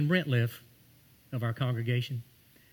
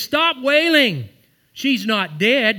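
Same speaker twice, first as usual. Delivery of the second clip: slow, loud, higher preached command; commanding Jesus; 7 semitones higher, 7 dB louder, much slower than usual. Acted. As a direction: halt the mourners with a slow loud call. Jesus rebukes the mourners.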